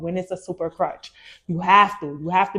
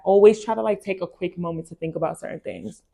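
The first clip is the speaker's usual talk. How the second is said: usual speaking voice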